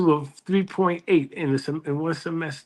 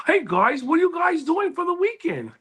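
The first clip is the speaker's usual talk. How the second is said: imitating a friend; 13 semitones higher than usual. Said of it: "he's like".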